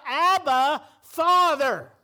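loud call of Abba, Father; crying out. Shown as a demonstration. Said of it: "makes you cry out".

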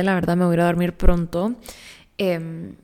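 usual speaking voice

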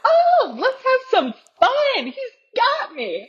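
a hammed-up carefree voice with a high Oh; mock carefree. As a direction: play your naive past self. Mocking her past carefree self.

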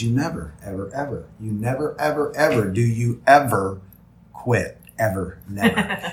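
emphatic retelling, ending on a laughing "never"; stretched emphasis. Retold or imitated. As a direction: stretch every "never" and "ever" to hammer the point home. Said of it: "I stretch it because I'm gonna put emphasis on it".

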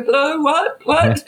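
high, mock just-woken questioning; feigned sleepy innocence. Answering the door to police, feigned sleepy innocence. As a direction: play puzzled, as if just woken. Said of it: "trying to get sleepy face on".

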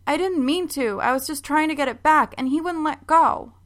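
put-on high, pleading child's voice; a girl explaining herself to her mom, high and pleading; defensive girl. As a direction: plead innocence like a caught kid.

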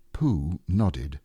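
ordinary delivery

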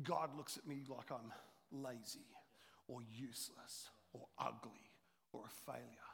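low, slow whisper of shame; a whispered inner voice of self-condemnation; whispered self-judgment. Pretend. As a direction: breathe it out like a secret fear. Voicing a hurt believer's inner thought.

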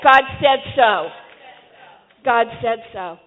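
loud, emphatic preacher's call; rousing declaration. Shown as a demonstration. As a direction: declare it firmly for all to repeat. Preacher leading congregation to repeat.

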